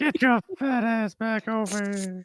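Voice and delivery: high put-on scolding voice; mocking a cartoon father calling his son; cartoon dad impression. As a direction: bark it at a lazy kid. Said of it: "That's what he says".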